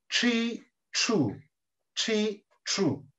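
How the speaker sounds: "Chi, chu" with the tongue curled back; soft, separate syllables "Chi, chu" with retroflex ch. Shown as a demonstration. Teacher introducing a new letter, retroflex ch.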